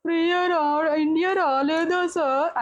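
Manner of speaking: put-on high, slow, childlike plea to the host; innocent pleading contestant. Contestant asking the host about his wife, in a high, slow, innocent voice. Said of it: "అన్నాడు".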